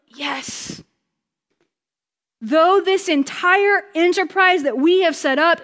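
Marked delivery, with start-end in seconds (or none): 0.1-0.8 a soft, breathed yes; hushed yes. Retold or imitated. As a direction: let it out under your breath in awe. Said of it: "we're just like".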